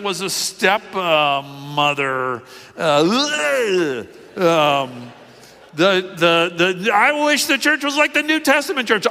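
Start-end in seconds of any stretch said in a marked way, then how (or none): 2.8-4.0 high shuddering "ugh" of revulsion; disgusted shudder. Acted. Pastor recoiling from the stepmother scandal, disgusted shudder. 6.9-9.1 very high mocking put-on voice; mock wistful. Naive churchgoer wishing for the early church, mocked.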